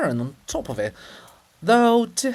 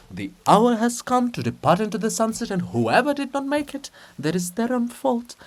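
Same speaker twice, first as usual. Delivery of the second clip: proclaiming; 9 semitones higher, 5 dB louder than usual. Acted. Chorus proclaims.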